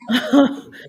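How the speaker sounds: short loud rising laugh; real laugh. Real, not acted. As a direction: burst out laughing at her own refusal. Laughs at her own refusal.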